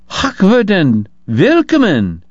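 high, drawn-out German greeting with warmth; warm, dignified welcome. A narrator's reading. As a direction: welcome an honored guest graciously, taking it slowly. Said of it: "she said with warmth and dignity".

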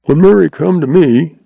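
lightly voiced fat man muttering; a hint of a bewildered old man; muttered. A narrator's reading. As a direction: grumble it to yourself. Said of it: "he muttered".

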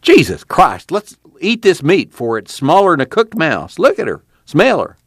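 mildly nervous, hungry call to eat; nervous cry. Acted. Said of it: "Jode cried nervously".

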